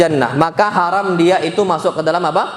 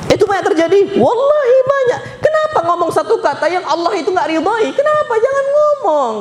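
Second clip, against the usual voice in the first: high, pleading sermon cry; impassioned preaching; 12 semitones higher than usual. Acted. Preacher pleads with the congregation not to curse, impassioned.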